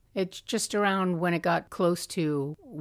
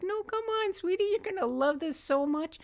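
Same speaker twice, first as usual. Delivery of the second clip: put-on high, sing-song coaxing of a baby; coaxing daddy voice; 13 semitones higher than usual. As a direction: lightly mimic a dad sweet-talking his baby. [name] coaxing crying baby.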